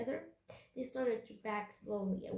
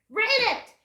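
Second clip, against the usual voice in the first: high, loud pirate bark; explosive captain's demand; growled command; 12 semitones higher, 12 dB louder than usual. A narrator's reading. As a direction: snarl the order at full volume. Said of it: "he growled".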